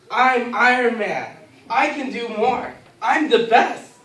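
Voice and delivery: loud, high, boastful declarations; mock bravado. Lifter outdone by others boasting to himself, loud mock bravado. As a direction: psych yourself up like a superhero. Psyching himself up to lift more.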